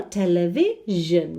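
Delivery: "Television" with the last syllable set apart as zh plus n; zh ending. Shown as a demonstration. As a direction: say "Television" ending in a buzzing "jeune" sound. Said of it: "would be another example there".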